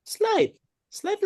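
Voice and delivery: high mock-innocent slight; playful high jab; teasing squeak. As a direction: toss it off in a high teasing pitch. Teasing jab at S1's blame.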